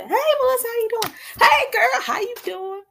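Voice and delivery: put-on high, loud, bubbly hey girl; squealed hello to a friend; bubbly greeting. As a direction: gush a big friendly hello.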